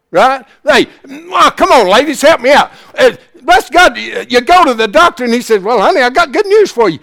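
high, loud revival preaching; preaching at full force. Real, not acted. Preacher firing up the ladies about expecting a baby. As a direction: preach it with everything you've got.

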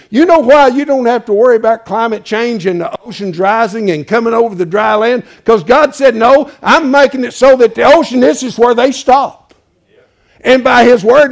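loud, driving pulpit voice; emphatic sermon delivery; full-force preaching. Acted. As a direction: press it home to the crowd. Preaching loudly on God's order.

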